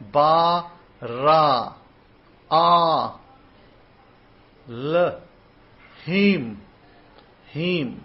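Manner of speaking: Ba, Ra, Ah, L, Him, Him as isolated held syllables; chant-like drill, "Ba, Ra, Ah", a pause after each; sounded-out characters. Shown as a demonstration. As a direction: recite each character separately, letting the vowel ring. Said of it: "These are the characters you're supposed to say".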